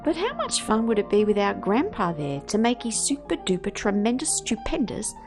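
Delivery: plain reading voice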